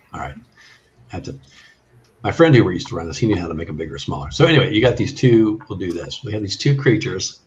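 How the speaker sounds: ordinary delivery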